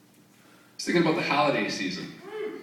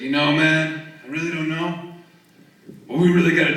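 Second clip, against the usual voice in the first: loose surfer-dude impression of a rock star; spaced-out musician shrugging about the ozone; 5 semitones higher, 6 dB louder than usual. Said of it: "interview with [name]".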